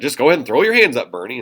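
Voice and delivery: imitating a debate rival's quick taunt; retold mocking jab. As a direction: toss it off as a mocking dare. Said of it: "he was mocking Bernie".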